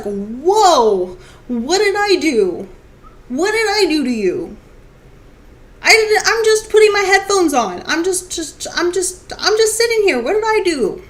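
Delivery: high-pitched, hammed-up innocence; squeaky, playful self-defense; mock bewildered protest. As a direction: plead innocence as if wrongly yelled at. Plays listener attacked by angry music.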